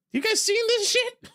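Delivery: laughing disbelief. Real, not acted. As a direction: laugh it out to the viewers. Laughing at absurd line.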